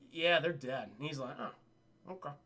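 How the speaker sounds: low, fading mock indifference; quiet, offhand shrug of a voice; deadpan acceptance. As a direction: play it underwhelmed, dropping away softly. Acting deadpan indifferent reaction.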